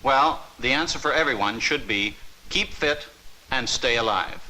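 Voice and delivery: ordinary talk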